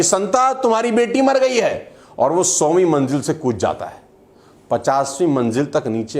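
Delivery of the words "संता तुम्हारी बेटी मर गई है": high-pitched urgent news; alarmed announcement. Acted. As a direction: break the shocking news in a raised pitch. Acted telegram news in joke. (घबराई हुई ऊँची आवाज़ में ख़बर)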